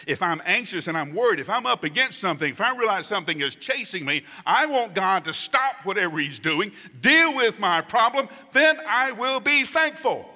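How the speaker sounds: rushed, high and loud, building to a near shout; acted agitation building. Preacher voicing an anxious believer bargaining with God, building agitation.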